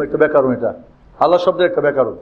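usual speaking voice

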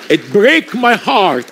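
ordinary delivery

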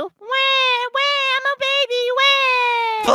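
put-on high, whiny baby wail, far above his own voice; mock crybaby impression. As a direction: bawl like a baby to tease your friend. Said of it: "I'm gonna do my impression of you".

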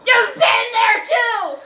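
high, loud accusation; accusing shout. Real, not acted.

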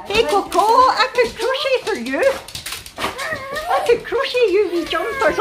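excited, high-pitched fussing; gushing puppy talk. Real, not acted. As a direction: promise a puppy treats in a high coo.

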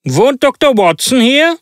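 loud, sharp voice. A narrator's reading. Visitor asks at the door for the doctor, loud and sharp. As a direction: call out the question in a high, sharp voice. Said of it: "fragte eine laute, etwas scharfe Stimme".

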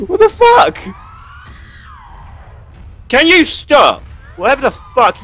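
high, loud screaming in fright; chased by a monster, screaming; terrified scream. Real, not acted.

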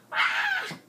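sharp, high, breathy shriek; a sudden startled scream, no voice in it. Acted. Toy character screams at the pink person, high shriek.

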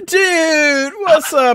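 high, loud dude greeting; stretched-out, whooping hello to a buddy; Felinor. Acted. Felinor greeting the one at the door, high and loud.

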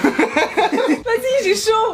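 talking through high laughter; laughing. Real, not acted. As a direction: laugh out the words. Laughing while being made up. (en riant aux éclats)

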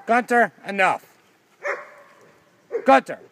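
sharp, high, loud scolding; yelling. Real, not acted. Owner scolding Gunter the dog, yelling. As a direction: yell at the dog to stop. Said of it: "am I yelling at the wrong one".

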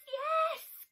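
a high, loud burst of joy; excited exclaim. A narrator's reading. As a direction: shout yes with delight like a happy bear. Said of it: "Bear exclaimed".

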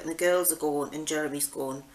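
ordinary talk

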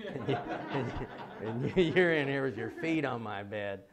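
laughing through the words; talking through real laughter. Real, not acted. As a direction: chuckle while recalling the scene. Laughing while retelling.

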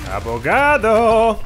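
excited yell. Real, not acted. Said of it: "Estoy gritando otra vez demasiado".

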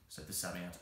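ordinary delivery

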